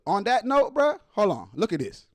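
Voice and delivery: normal talking voice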